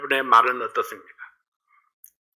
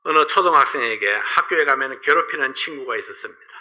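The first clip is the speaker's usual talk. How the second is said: ordinary delivery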